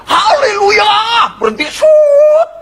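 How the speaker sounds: high, loud panicked scream with a cry. Acted. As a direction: scream it at the cliff edge. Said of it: "Dia teriak".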